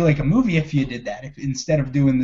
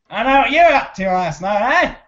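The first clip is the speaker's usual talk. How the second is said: higher, hammed-up Australian twang; most Australian ever; 5 semitones higher, 3 dB louder than usual. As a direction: imitate an over-the-top Australian cop. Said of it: "he was the most Australian person ever".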